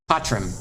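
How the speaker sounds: "patrum" with T and R as one quick consonant; blended T R. Shown as a demonstration. Teacher demonstrates the quick cluster, T R blended. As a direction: run the T straight into the R in "patrum". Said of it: "You say T R so fast".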